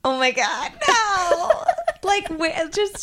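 high shocked laugh. Real, not acted. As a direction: laugh out a horrified no. Listener reacts to ghost shove.

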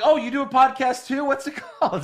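eager question. Retold or imitated. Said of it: "I was like".

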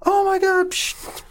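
put-on very high, squealing child calling his dog; a kid screaming with joy at his dog; high-pitched child's delighted cry; overjoyed kid. Kid sees his dog return.

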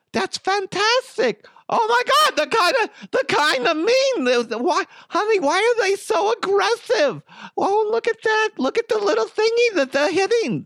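very high falsetto doting mom; Mama DeHuff. Acted. Mom fretting to honey over hockey on TV, shrill falsetto. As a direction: squeal and fret like a fussy mother. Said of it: "Mama DeHuff watching hockey".

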